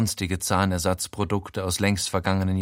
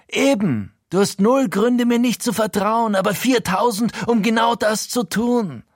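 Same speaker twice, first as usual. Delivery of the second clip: much higher, louder bawling rant; 12 semitones higher, 5 dB louder than usual. A narrator's reading. Frankie bawling at his friend, completely gone. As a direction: bawl it at him, losing it. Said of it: "plärt er".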